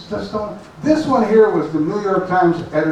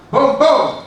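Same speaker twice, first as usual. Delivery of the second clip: higher, louder imitated gunfire; shouted gunshots; 4 semitones higher, 5 dB louder than usual. A narrator's reading. Narrator voices the shots fired at Tonal, shouted gunfire. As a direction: punch out two booming shots. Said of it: "then shouts".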